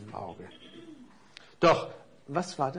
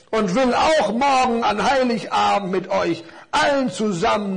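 lauter, langsamer, mahnender Baum; strenger Weihnachtsbaum; 5 semitones higher, 6 dB louder than usual. Acted. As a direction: streng zur Ordnung rufen. Baum hält strenge Rede. (mahnende, gewichtige Baumstimme)

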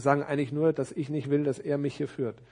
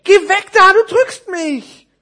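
very high, loud complaint; high squeal, stretched out at the end; squashed little parcel; 18 dB louder, slower than usual. Acted. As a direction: yell indignantly in a tiny character's voice.

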